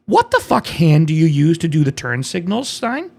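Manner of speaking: ordinary talk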